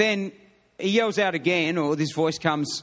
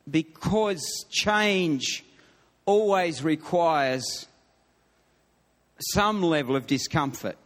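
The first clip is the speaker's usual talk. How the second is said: slow emphatic point; 2 semitones lower, slower than usual. Real, not acted. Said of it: "slows to stress a sermon point".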